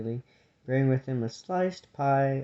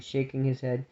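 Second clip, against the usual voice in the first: ordinary delivery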